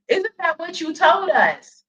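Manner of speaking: ordinary talk, nothing marked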